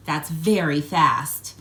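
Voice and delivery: ordinary delivery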